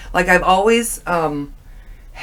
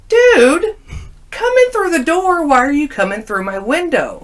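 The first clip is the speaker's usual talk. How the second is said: loud, high startled yell at a friend; startled shout; 9 semitones higher, 5 dB louder than usual. Retold or imitated. Yelling at a friend climbing in her window, startled. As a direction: shout it out, caught off guard.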